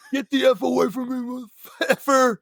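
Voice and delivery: high, hammed-up mock yell; angry warning. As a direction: imitate a rattled man ordering someone back. Retold guy warning him off.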